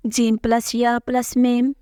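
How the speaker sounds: "jim plus ya plus mim" spelled slowly, letter by letter; slow spelling out. Shown as a demonstration. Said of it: "Write this and read it".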